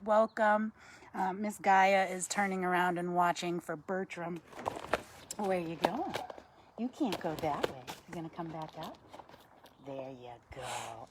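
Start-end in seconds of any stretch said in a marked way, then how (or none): 5.4-11.1 murmured pet talk; soft coaxing. Real, not acted. Host talking to her owl as it moves, soft coaxing.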